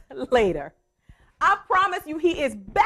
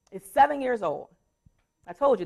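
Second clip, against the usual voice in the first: ordinary talk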